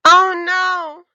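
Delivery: loud, high panicked cry; child wakes up realizing the mistake; loud wailing oh no; yelled. A narrator's reading. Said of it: "yelled Ebony".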